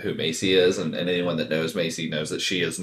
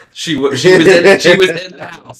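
laughing disbelief; 10 semitones higher, 11 dB louder than usual. Real, not acted. Retelling absurd call, laughing.

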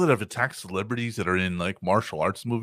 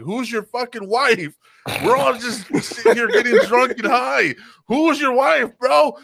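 much higher and louder, disbelieving; incredulous drunk shout; 12 semitones higher, 7 dB louder than usual. Retold or imitated. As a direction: yell back at the gunman in disbelief. Drunk wrestlers answering armed husband.

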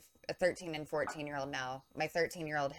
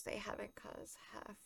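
whispered; 4 semitones higher, 16 dB quieter than usual. Real, not acted. Dropped aside.

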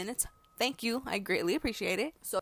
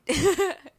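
sudden high loud laugh; loud burst of laughter; 9 semitones higher, 14 dB louder than usual. Real, not acted. Laughs at own line.

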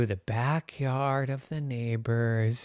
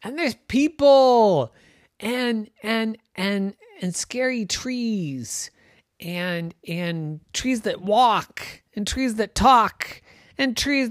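put-on high, fearful child; scared Jenny; 9 semitones higher, 3 dB louder, slower than usual. Jenny telling her brothers about scary trees, high and afraid. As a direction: build the spooky list slowly. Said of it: "Jenny said".

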